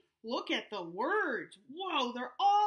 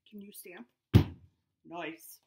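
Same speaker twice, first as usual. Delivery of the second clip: ordinary delivery, nothing marked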